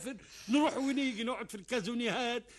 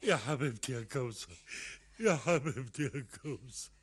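tender; 8 semitones lower than usual. Acted. Tender relief.

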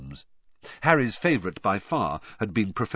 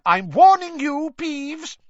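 high, stern warning schoolmistress; stern teacher; 9 dB louder, slower than usual. Acted. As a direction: warn him sternly, voice raised. McGonagall warning Peeves.

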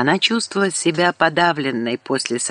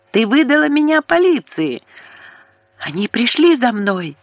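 higher, louder frantic yelling; furious shout; 8 semitones higher, 4 dB louder than usual. A narrator's reading. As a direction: scream it in panic and rage. Furious caller on the phone.

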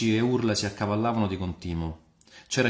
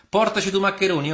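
raised, high-pitched call; calling out an order; 10 semitones higher, 6 dB louder than usual. Acted. Diner orders maccheroni from the innkeeper, calling out high and loud. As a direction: call out loudly to be served.

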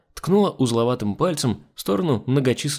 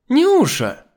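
high, loud exclamation; dismayed cry; 7 dB louder than usual. Acted. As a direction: cry out her name in dismay. Dismayed hostess cries out to maid. (громкий высокий возглас)

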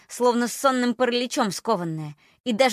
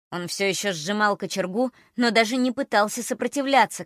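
ordinary delivery

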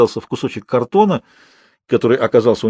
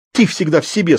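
hurt; 6 semitones higher than usual. A narrator's reading. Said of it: "сказала она с обидой".

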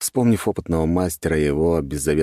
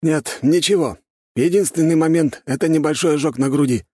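higher, louder gendarme chief, lightly voiced; 12 semitones higher, 5 dB louder than usual. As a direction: lightly play an official giving findings, pitched up. Ответ главы жандармов. (слегка сыгранный начальник жандармов, голос выше)

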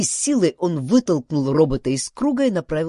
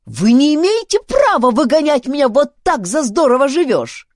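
high, loud indignation; outraged, raised-voice protest; 9 semitones higher, 9 dB louder than usual. A narrator's reading. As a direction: object heatedly to being thrown out. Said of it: "запротестовал Дункан".

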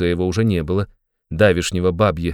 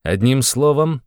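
slow, higher, firm demand; raised voice pressing the point; loud and insistent; 6 semitones higher, slower than usual. A narrator's reading. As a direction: cut in insistently, drawing the words out. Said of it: "настойчиво и громко сказал он".

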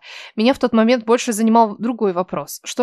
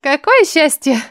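выше, с иронией; скажи с насмешкой; иронично; 5 semitones higher, 3 dB louder, slower than usual. Acted. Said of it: "иронично выдала Ниночка". (иронично)

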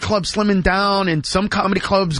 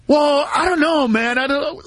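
defensive shout; 7 semitones higher than usual. Retold or imitated. Friend pushing back in retold call.

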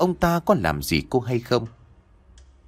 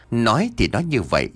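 usual speaking voice

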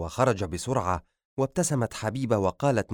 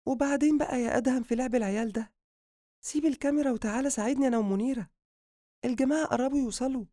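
high, quick wife voice, playfully nagging; chiding wife character; 14 semitones higher, faster than usual. Acted. Wife asking husband to drop the camera and help, high quick chiding. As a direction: voice a busy wife scolding lightly.

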